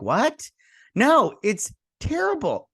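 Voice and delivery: high-pitched, disbelieving comeback; acted exasperated "what?"; incredulous retort. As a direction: push back in high disbelief. Acted retort to show's defenders.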